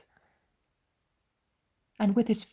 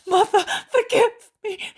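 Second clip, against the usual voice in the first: very high, loud crying plea; sobbing wail for forgiveness; wailing sob; 9 dB louder than usual. Acted. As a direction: cry out to his mother through tears.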